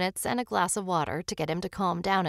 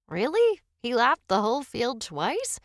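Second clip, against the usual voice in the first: high, incredulous questioning; surprised, pitched-up disbelief; amazed question; 4 semitones higher, 5 dB louder than usual. Acted. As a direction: ask in astonishment. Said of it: "Tommy asked".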